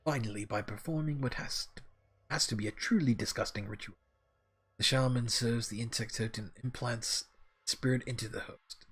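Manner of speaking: lightly voiced mentor, measured and uneven; wandering character voice. Algernon explains insect spirits to the orc, in a lightly voiced, wandering character voice. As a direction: explain like a learned character, the voice drifting slightly. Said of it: "My voice for him is just going all the way around".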